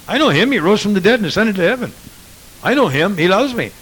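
loud, high, proud recital of facts about Jesus; mock know-it-all believer. Preacher mocks head-knowledge believer, loud and high. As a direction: boast about knowing him like a list of facts. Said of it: "It's not an intellectual fellowship".

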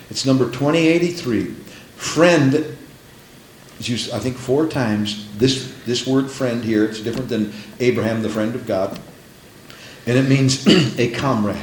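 ordinary talk, nothing marked

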